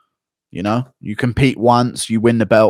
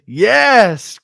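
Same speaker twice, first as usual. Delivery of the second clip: loud, high cry of triumph; thrilled yell; 9 semitones higher, 7 dB louder than usual. Real, not acted. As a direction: exclaim it like your idea just won. Thrilled at finding the nickname tweet.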